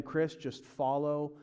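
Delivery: slow, gentle, soft reassurance; slow and calm; God's calm voice. Acted. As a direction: speak slowly like a patient father. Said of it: "God says".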